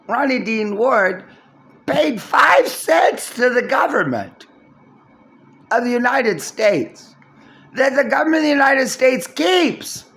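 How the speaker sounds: loud, high, worked-up shouting; outraged yell over a five cent deposit; indignant rant. Real, not acted. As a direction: rail against the government at full volume. Ranting about bottle deposit.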